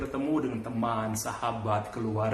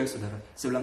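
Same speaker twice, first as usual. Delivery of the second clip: usual speaking voice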